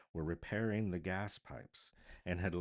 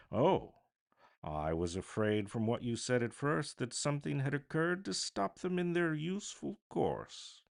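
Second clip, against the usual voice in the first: lightly voiced gentleman, higher; a polite gentleman sounding relieved, pitched up; 8 semitones higher, 4 dB louder than usual. A narrator's reading.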